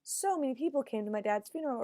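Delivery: impressed by the crowd, voice lifted high; amazed wow. Shown as a demonstration. Daughter marvelling at turnout for dad's funeral, amazed. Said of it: "is this like".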